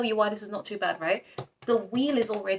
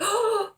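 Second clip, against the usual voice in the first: excited gasp; 13 semitones higher, 4 dB louder than usual. Real, not acted. Excited over Pisces reading.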